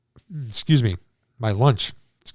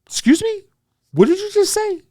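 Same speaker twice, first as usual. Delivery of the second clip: very high, squeaking excuse me; shocked disbelief; 3 dB louder than usual. Retold or imitated. Stunned after hearing ABDL.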